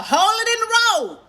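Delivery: high, loud stern order from grandma; grandma's stern order. Retold or imitated. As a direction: call it out sharply like a grandma in the passenger seat. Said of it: "That's how she'd say it".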